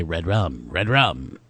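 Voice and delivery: low, soft eerie chant; hushed, low horror-film child; creepy twins. Acted. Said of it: "have them say".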